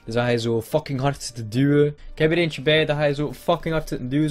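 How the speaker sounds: normal talking voice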